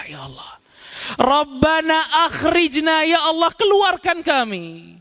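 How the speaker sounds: high wailing cry, slow on akhrijna; crying out from hell. Acted. Damned souls in hell crying to God, wailing. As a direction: cry out to be taken out.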